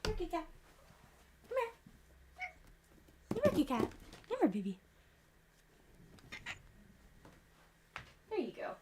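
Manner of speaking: soft, high coaxing call; coaxing a pet. Real, not acted. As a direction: gently coax the cat over. Coaxing cat up to food.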